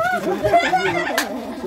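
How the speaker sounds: a high squeal of laughter; giddy high-pitched laugh. Real, not acted. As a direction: burst into squeaky giggles. Laugh amid playful teasing.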